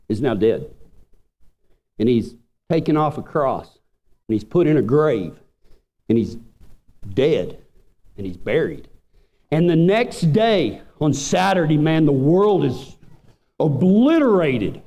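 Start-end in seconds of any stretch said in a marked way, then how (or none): 0.1-8.9 preacher going hushed at the grave; solemn hush. Acted. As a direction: let the weight of his death sink in. Solemn telling of Jesus's death.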